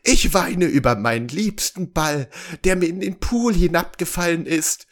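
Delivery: high, tearful storybook princess voice; mock-weepy princess. A narrator's reading. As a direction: lament the lost ball, acted. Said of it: "schluchzte sie". (gespielt weinerliche Prinzessin)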